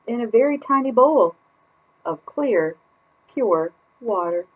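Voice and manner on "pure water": ordinary delivery, nothing marked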